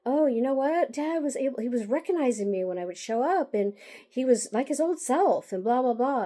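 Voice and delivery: high, loud, gushing mock excitement; imitating sister's bubbly fake excitement; excited. Mocking sister's excited news about dad, loud and high. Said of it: "she was telling me all excitedly".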